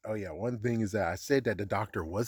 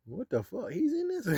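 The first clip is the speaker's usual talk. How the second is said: mock surprise; 12 semitones higher than usual. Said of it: "it's like".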